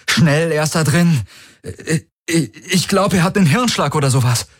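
high, stammering urgency; feigned panic. A narrator's reading. Man urges the arriving guards to help, in stammering feigned panic. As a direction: blurt it out fast, stumbling over the start.

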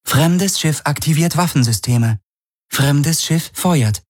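ship computer voice. A narrator's reading.

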